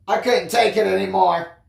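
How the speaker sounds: usual speaking voice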